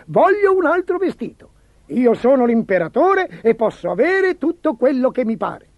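high, loud, spoilt demand; petulant ruler asserting his power at high pitch; imperial tantrum. A narrator's reading. Emperor's tantrum over new clothes.

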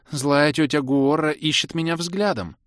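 a hint of a little daughter speaking; daughter's announcement. A narrator's reading. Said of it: "неожиданно сообщила дочь".

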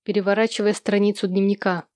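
plain reading voice

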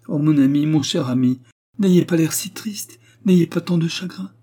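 high, earnest plea to a dear friend; anguished tender entreaty; imploring. A narrator's reading. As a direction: beg him to take comfort, voice lifted. She implores her grieving friend.

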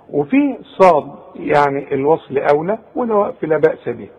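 ordinary talk, nothing marked

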